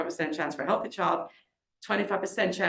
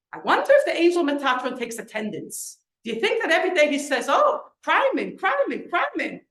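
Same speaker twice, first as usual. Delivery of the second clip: higher, louder, agitated wondering; retold upset, with a mock roll call; upset questioning; 7 semitones higher, 5 dB louder than usual. Upset mother wondering aloud if the angel takes attendance, agitated. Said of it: "then I started to get upset".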